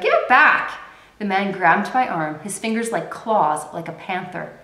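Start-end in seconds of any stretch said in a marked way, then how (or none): 0.0-0.7 high, loud desperate shout. Acted. Girl fighting the thief for her bucket, shouting high and loud. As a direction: yell it as you cling to the bucket. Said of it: "I held tight to the bucket and shouted".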